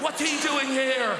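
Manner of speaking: high-pitched mock panic; panicked alarm. Office workers startled by an unexpected visitor, high panicked cry.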